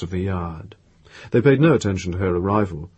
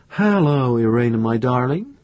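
higher-pitched affectionate hello with a smile; happy, smiling greeting; 4 semitones higher than usual. A narrator's reading. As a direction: greet a beloved daughter happily. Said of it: "said with a slow, happy smile".

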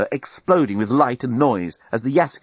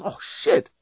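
sudden dismay. A narrator's reading. Dan sudden alarm.

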